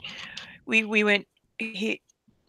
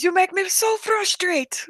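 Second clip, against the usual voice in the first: very high, slow mock Arabic-accented whine; drawn-out exasperation in imitated Jordanian accent; hammed-up Arabic accent, pitched way up; frustrated Arabic accent; 11 semitones higher, slower than usual. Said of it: "somebody with a strong accent".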